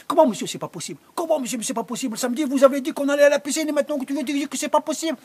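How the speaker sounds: fast, high, indignant outcry; rapid high-pitched protest. Acted. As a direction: kids yelling in disbelief at the organiser. Youths protesting to Gérard.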